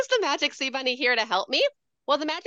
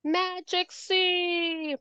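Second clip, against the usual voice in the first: high, slow playful squeak; very high silly guiding chant; squeaky silly voice; 6 semitones higher, 4 dB quieter, much slower than usual. Shown as a demonstration. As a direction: sing it squeaky for the kids. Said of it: "Should I use a silly voice?".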